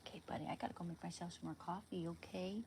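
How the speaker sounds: fast whisper. Real, not acted. Owner whispering quickly to her dog before fetching coffee. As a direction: rush a hushed goodbye to the puppy. Telling the dog she must go.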